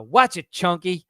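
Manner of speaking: lightly acted, drawn-out rebuke; quoted Calloway warning. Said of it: "like Calloway says to the mayor".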